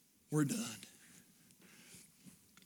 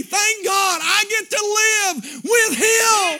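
high, loud shouting preacher's cry, slowing at the end; full-force preaching; 14 dB louder than usual. Acted. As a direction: shout the joy of heaven to the rafters.

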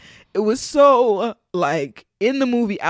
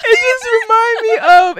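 high, loud tearful voice; crying; 13 semitones higher, 11 dB louder than usual. Real, not acted. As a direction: push words through tears. Overcome with emotion.

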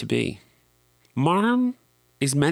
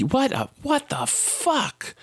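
outraged; slower than usual. Real, not acted. Outraged at being blacklisted.

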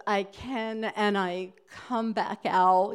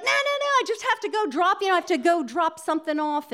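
high, fast, breezy friend's voice; friend brushing her off; 7 semitones higher, 3 dB louder, much faster than usual. Retold or imitated. Storyteller voicing her friend who refuses to stop: high, fast, breezy. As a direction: rattle it off high and quick, no time to stop. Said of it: "And he's like".

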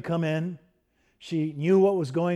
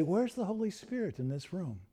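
much softer, inward searching question; quiet wondering; 2 semitones higher, 9 dB quieter than usual. Retold or imitated. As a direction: ask it quietly, almost to yourself. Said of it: "she'd say".

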